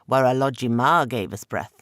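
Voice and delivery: a proud spirit showing off his home; trickster god Eshu. Acted. Trickster god's voice.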